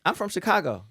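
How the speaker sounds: high, loud incredulous protest; indignant disbelief. Retold or imitated. Student protests to coach about Southern accent remark, incredulous. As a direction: sputter it out, loudly affronted.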